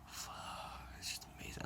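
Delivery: hushed, voiceless whisper; awed whisper. Real, not acted. Marveling at a favorite book, awed whisper. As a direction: whisper it under your breath in amazement.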